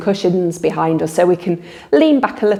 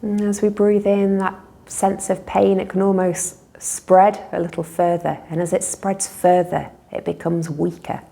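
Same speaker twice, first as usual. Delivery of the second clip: calm guided relaxation with long pauses; guided meditation; 3 dB quieter than usual. Real, not acted. Teacher leading listeners in a body-awareness relaxation, unhurried meditation voice. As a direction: guide them gently through noticing breath and body.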